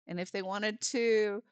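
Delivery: normal talking voice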